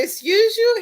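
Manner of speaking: high, loud, indignant "excuse you"; offended retort. Retold or imitated. Girlfriend retorting to her boyfriend's accusation, high and indignant. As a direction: snap back like you can't believe he said that.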